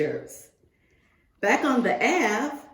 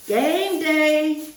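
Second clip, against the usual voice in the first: higher and louder, excited shout; game day cheer; 7 semitones higher, 5 dB louder than usual. Pretend. Fan announcing game day, excited shout.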